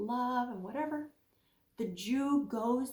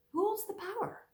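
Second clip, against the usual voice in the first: same question lifted much higher; higher repeat; 11 semitones higher than usual. Real, not acted. Preacher repeats the question to listeners, higher. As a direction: ask it again, up high, searching.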